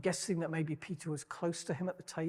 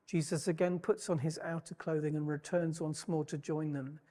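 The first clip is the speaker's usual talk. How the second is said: ordinary talk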